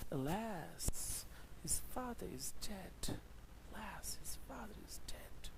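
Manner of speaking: soft grieving whisper; sorrowful whisper. Shown as a demonstration. Teacher shows students the emotion of an exclamatory sentence, a sad whisper. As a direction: breathe out the news of a death in grief. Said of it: "you have to have an emotion".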